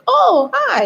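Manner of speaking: very high, acted surprised hello; high-pitched mock surprise; surprised greeting. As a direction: imitate someone suddenly recognizing a friend. Acted caretaker recognizing her.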